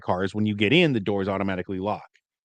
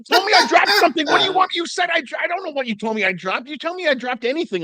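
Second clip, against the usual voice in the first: very high-pitched, worked-up retort; mock indignant rant. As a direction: spill it out in a squawking protest. Acts out an indignant comeback.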